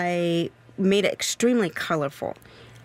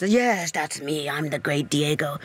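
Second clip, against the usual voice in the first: posturing Diego. Acted. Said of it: "he tries to posture in front of the villagers".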